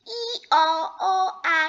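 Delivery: imitated hee-haw; storybook donkey noise; donkey bray. A narrator's reading. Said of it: "the donkey was braying".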